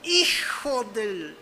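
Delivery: arrastra muchísimo las palabras y déjalas en suspenso; exclamación de casi grosería. Retold or imitated. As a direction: casi maldición, muy lenta, sin terminar. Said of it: "cuando alguien dice". (improperio contenido, alargado y cortado antes del final)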